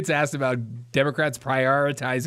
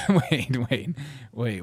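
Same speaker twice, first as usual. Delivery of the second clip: ordinary delivery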